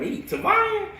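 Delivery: very high-pitched mock questioning; imitated teacher. Science teacher trying his first name, mock puzzled question. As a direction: mimic a teacher unsure of the name. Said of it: "he trying to say my first name".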